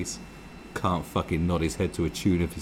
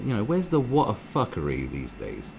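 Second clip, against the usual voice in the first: normal talking voice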